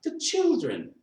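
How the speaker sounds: very high-pitched gentle appeal; pleading reminder. A narrator's reading. Bob reminds his wife of the children, pleading.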